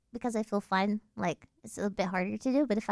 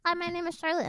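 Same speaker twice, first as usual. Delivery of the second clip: higher, sweet-and-simple introduction; high normal introduction; 6 semitones higher than usual. Shown as a demonstration. Introducing herself with a fun fact, pitched high. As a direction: introduce yourself up high before the drop. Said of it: "I would be like".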